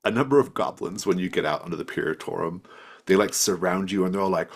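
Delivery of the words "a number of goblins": ordinary delivery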